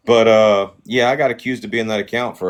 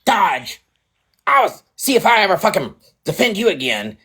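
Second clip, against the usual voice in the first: high, mocking impression of an angry woman; a sneering impersonation of her rant, much higher; 9 semitones higher than usual. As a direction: mimic her whiny outrage, pitched way up.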